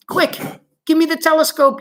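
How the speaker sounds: urgent high yell. Acted. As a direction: bark for the telescope, urgently. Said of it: "yelled Shuckworth".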